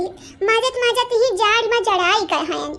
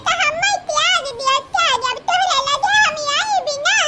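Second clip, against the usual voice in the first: put-on higher whiny child voice; complaining child; 4 semitones higher than usual. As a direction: whine at mother like a hurt kid.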